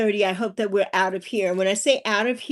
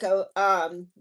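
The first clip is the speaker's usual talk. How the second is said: usual speaking voice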